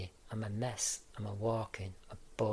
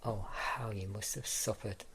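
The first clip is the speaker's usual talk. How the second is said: usual speaking voice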